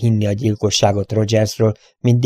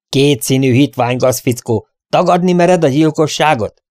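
loud, high-pitched furious accusation; booming outrage, climbing on the challenge; thundering; 5 semitones higher, 6 dB louder than usual. A narrator's reading. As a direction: thunder the insults at him. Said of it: "dörögte Derrick".

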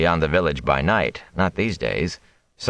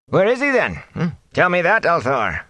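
challenging thatcher; 12 semitones higher than usual. A narrator's reading. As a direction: demand an answer, jabbing. Sen challenging.

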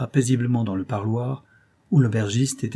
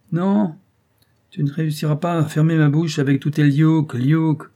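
high, louder, defiant retort; defiant husband; 7 semitones higher, 6 dB louder than usual. Acted. Husband defying his wife's warning in front of Robert, higher and louder. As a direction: refuse to be silenced, louder and higher.

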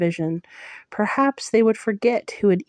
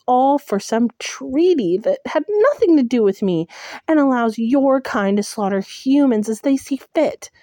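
higher and louder, indignant cry; angry outburst; 5 semitones higher, 4 dB louder than usual. Acted. Heroine defying Tamlin, loud, high and indignant. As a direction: let it burst out at him.

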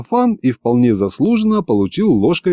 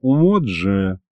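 low, stretched, deflated exclamation; disappointed drawl; 3 semitones lower than usual. A narrator's reading. Aristofan got beaten to the idea, low disappointed drawl. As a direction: let it sag slowly in disappointment. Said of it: "разочарованно протянул Аристофан".

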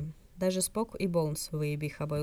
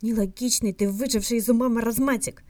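louder, higher furious insult at the hologram; angry insult; 5 semitones higher, 7 dB louder than usual. A narrator's reading. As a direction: spit the insult out at the old Vulcan. Jim curses the hologram. (Джим громко и зло ругает голограмму Сакела)

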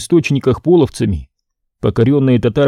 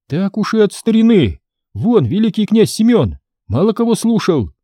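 put-on defiant boy prince; 9 semitones higher than usual. Prince retorts.

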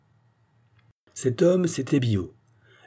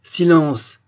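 commanding call; 6 semitones higher, 7 dB louder than usual. A narrator's reading. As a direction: cut him off with a raised voice. Biot silences the abbé. (ordre sec, voix haute et forte)

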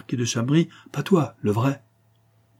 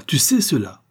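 higher, louder second take, rising in alarm; alarmed repeat; 6 semitones higher, 5 dB louder than usual. A narrator's reading. False marquis realizes Zampa knows his secret, alarmed. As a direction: repeat it harder, pitch jumping up on "sais".

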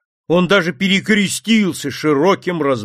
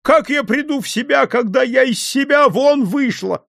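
wailing; 10 semitones higher than usual. Acted. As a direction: wail it out, beside herself. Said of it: "ещё больше завопила купчиха".

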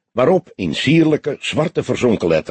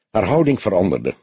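usual speaking voice

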